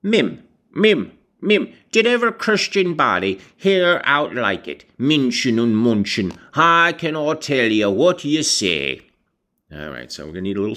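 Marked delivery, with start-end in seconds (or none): 0.0-9.0 high, crabby rustic mimicry in thick dialect; Joseph. A narrator's reading. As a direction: screw up your nose and mock her in broad dialect. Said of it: "screwed up his nose and replied".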